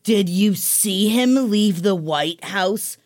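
slow, drawn-out mock indignation; imitating mad mom. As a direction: act out an incredulous mother, spacing every word. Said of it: "Why are you so mad?".

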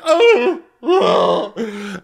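high, loud whimpering groans; mock groan. [name] straining through a plank, high loud mock whimper. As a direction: whine like a plank is killing you. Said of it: "crying 'cause he's having".

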